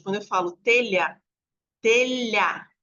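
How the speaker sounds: "telha" said with lh as one sound straight into the A; telha as digraph. Shown as a demonstration. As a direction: glide "telha" lh straight to the vowel. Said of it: "eu já vou direto pro A". ("telha" com o lh como um som só)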